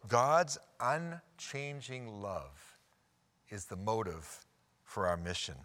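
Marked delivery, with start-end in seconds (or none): 0.1-2.8 God's unchanging love, drawn out very slowly; slow emphasis. Acted. Preacher stressing God's love to the congregation, very slow. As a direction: stretch every word of God's unchanging love.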